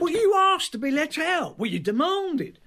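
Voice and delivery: high-pitched, defensive protest; indignant retort. Acted. Matt defends leaving her at the roadside, indignant. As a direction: throw the blame back, voice pitched right up.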